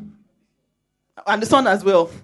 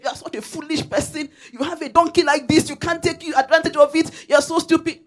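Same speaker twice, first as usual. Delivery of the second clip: higher mock scorn; mocking villagers; 7 semitones higher than usual. Villagers jeering at father and son who walk, higher mock scorn. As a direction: jeer at them for wasting a donkey.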